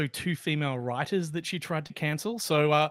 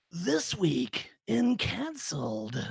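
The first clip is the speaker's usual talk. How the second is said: movie trailer voice; much slower than usual. Shown as a demonstration. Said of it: "how about in movie guy voice?".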